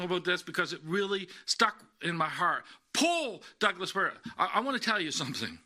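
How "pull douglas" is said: high, urgent call of pull from the stands; father's shout from the crowd. Retold or imitated. Father cheering his son at tug of war, shouting high. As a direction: holler it like a dad cheering from the sidelines. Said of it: "those words hollering at me".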